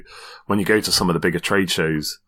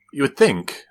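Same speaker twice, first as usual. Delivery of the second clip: ordinary talk, nothing marked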